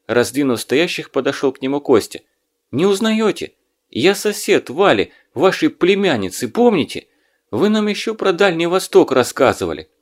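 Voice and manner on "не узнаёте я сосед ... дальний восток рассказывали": eager. Acted. Young radio man eager recognition.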